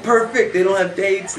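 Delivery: high, loud burst of glee; excited buddy. Retold or imitated. Buddy hears the cheerleaders lack dates, excited. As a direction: exclaim it as a thrilled teenage schemer. Buddy's eager reaction, acted in story.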